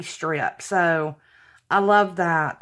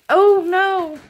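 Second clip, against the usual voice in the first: high, loud cry of dismay; 9 semitones higher, 5 dB louder than usual. Real, not acted. Crafter dropping a gift on camera, dismayed. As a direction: exclaim at a sudden mishap.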